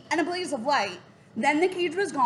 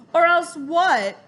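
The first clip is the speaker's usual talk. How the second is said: louder, slow, sneering dare; a villain daring a child, louder; taunting challenge; 3 semitones higher, 6 dB louder, slower than usual. Acted. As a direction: dare the boy to answer. Raven King taunting Jack.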